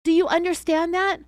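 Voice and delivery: a suspect screaming at the agent; narrated scream. A narrator's reading. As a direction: scream it back at him. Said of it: "Teresa screamed".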